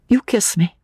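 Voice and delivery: put-on high, tender young woman; girl's voice. A narrator's reading. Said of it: "said the girl".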